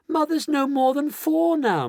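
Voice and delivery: high-pitched alarmed cry. A narrator's reading. Daughter watching her mother shrink, crying out. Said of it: "Missus Bucket cried out".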